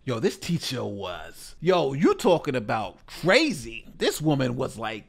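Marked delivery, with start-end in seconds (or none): none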